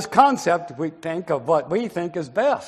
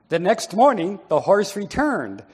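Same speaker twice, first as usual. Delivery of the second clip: ordinary delivery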